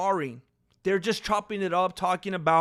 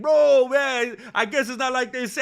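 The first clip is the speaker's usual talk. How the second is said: high, teasing imitation of the guys; mock-amazed teasing; mocking banter; 5 semitones higher, 5 dB louder than usual. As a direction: rib a buddy, playfully disrespectful. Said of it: "it could have easily come across as mocking".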